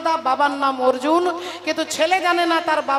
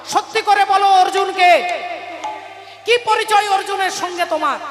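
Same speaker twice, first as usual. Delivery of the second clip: demanding son; 3 semitones higher than usual. Acted. Son angrily demands the truth.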